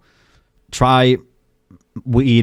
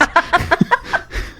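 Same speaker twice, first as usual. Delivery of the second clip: goblin laugh. Acted. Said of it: "The gremlin in you came out".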